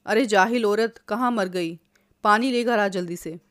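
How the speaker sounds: plain reading voice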